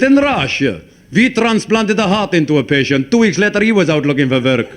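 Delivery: put-on comic Russian accent. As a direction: tell it as a boastful Russian in an accent. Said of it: "A Russian fella got up".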